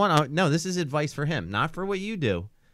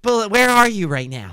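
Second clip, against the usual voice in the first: higher, louder worried mum; 7 semitones higher, 8 dB louder than usual. Pretend. Bullet's mum demanding where her son is. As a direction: demand it like a fretting mother.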